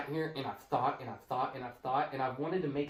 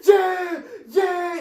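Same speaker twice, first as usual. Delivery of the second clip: wild high cheering shout, much louder and slow; crowd going crazy; 14 dB louder, much slower than usual. Retold or imitated. Host voices the crowd erupting after the knockout, shouting. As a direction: roar like fans after a knockout. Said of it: "Everybody's going crazy".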